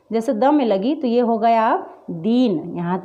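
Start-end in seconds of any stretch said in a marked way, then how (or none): 2.1-2.8 "दीन" with a long, weighted ee. Shown as a demonstration. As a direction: stretch the vowel of "दीन". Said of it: "ज़्यादा जोर देकर होता है". ("दीन" में बड़ी ई, ज़ोर देकर)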